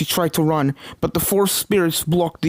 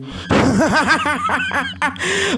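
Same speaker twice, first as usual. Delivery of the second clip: booming triumphant laugh; 11 semitones higher than usual. A narrator's reading. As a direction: laugh like you have won. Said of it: "Starlight let out a booming laugh".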